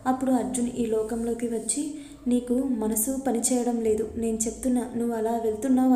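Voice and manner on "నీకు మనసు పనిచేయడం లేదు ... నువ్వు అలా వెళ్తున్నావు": plain reading voice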